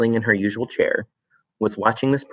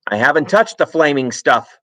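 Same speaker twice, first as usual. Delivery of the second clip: shouted; 6 semitones higher, 4 dB louder than usual. Acted. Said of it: "Mister Wormwood shouted".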